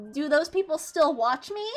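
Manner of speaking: normal talking voice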